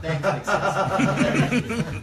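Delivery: slow, loud, drawn-out laughing thanks; mock thanks to big sister; sarcastic thanks. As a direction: drag it sarcastically.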